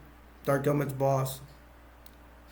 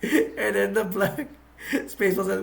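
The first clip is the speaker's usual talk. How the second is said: laughing; 5 semitones higher than usual. Real, not acted. Laughing at the racist joke.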